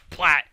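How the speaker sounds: sudden loud splat; imitated splat. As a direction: punch out the impact noise. Imitates ball hitting grass.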